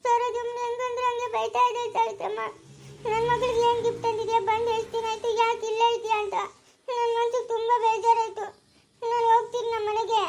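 high, crying complaint; upset child-like whimper, pitched high; tearful. Acted. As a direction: sob out the hurt in a high wail. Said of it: "ಯಾಕೆ ಅಳ್ತಾ ಇದ್ಯಾ?".

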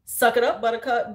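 loud, no-nonsense challenge; tough-love scolding. Acted. Teacher putting a student in his place, tough-love scolding. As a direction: lay down the law, loudly. Acting out her tough classroom manner.

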